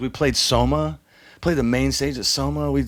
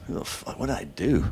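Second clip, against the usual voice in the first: hushed confusion; 8 dB quieter than usual. Retold or imitated. Waking up hungover, confused.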